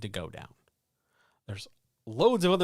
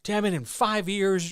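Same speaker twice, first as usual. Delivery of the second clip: mock angry teenager; 6 semitones higher, 5 dB louder than usual. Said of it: "Not in some, like, teenage, angry, spicy".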